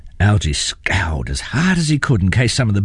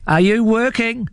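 mild mock shout, much higher. A narrator's reading. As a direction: call down to the tiny ants. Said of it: "he shouted".

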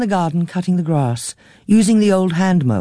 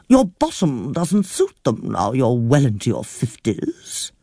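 put-on a fretful elderly aunt complaining breathlessly; flustered old aunt. A narrator's reading. Aunt criticizes niece.

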